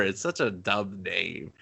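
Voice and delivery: normal talking voice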